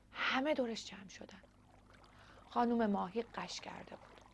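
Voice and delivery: plain reading voice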